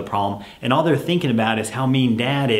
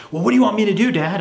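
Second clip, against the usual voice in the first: much higher and louder, exasperated; whiny kid; 9 semitones higher, 4 dB louder, much faster than usual. Acted. Son on the phone whining at dad, high and loud. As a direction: whine at dad in frustration. Child whining in role-play.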